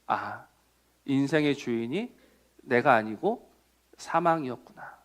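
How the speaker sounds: low, hushed dawning thought; quiet realization. Acted. Preacher voicing someone at death's door, quiet realization.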